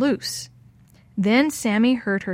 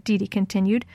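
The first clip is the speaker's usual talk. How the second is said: ordinary delivery, nothing marked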